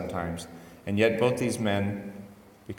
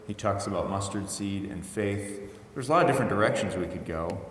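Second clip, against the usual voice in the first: normal talking voice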